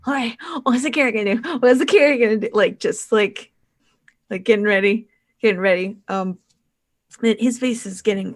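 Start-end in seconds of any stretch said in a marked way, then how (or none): 0.1-2.5 put-on excited little boy, pitched higher and quick. Game master voices a little boy watching someone eat a carrot, eager child voice. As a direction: get worked up like a watching child. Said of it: "and the little boy's like".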